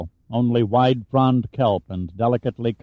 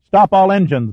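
loud, higher yell of command; yelled order; 8 semitones higher, 6 dB louder than usual. A narrator's reading. Commander yelling into the intercom to stop engines, loud. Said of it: "Boland yelled into the intercom".

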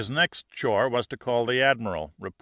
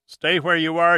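loud, sharp command; firm order; 6 semitones higher, 7 dB louder than usual. A narrator's reading. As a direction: bark the order to stay put. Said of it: "Giordino ordered".

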